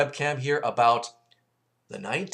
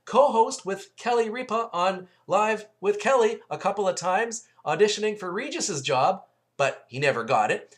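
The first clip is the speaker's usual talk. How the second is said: excited viewer; 10 semitones higher than usual. Retold or imitated. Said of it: "and you'll go".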